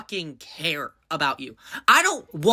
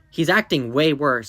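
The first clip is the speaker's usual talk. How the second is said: normal talking voice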